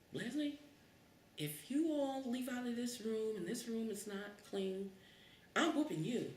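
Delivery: high, stern mother's warning; a hint of a scolding mom; mom's threat. Mom warning the kids to clean the room, stern.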